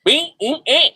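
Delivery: spelling it out in a high, bleating drawl; Southern drawl. Retold or imitated. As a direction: imitate a thick Southern twang, letter by letter. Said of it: "if you'd have heard the drawl".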